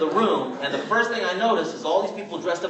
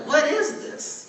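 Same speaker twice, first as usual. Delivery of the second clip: high, slow, baffled question; 12 semitones higher, slower than usual. Retold or imitated. Young son watching the tape, high and baffled. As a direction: sound stunned by what you see.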